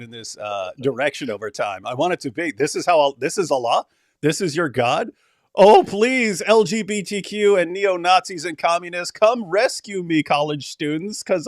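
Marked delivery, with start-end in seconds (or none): 5.5-11.5 higher, a touch louder, lightly whiny; half-hearted mocking cry for rescue; mock plea.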